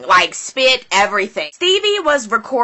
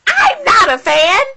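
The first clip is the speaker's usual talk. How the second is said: mock outcry; 10 semitones higher, 5 dB louder, slower than usual. Retold reaction, shouted.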